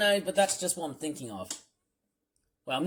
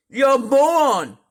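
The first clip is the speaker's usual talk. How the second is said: loud, higher sneering jab; scornful insult; 6 semitones higher, 12 dB louder than usual. Real, not acted. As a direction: hurl the insult with contempt. Insulting the character.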